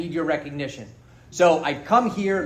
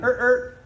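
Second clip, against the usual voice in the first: car alarm chirp; 7 semitones higher than usual. Retold or imitated. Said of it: "and I went".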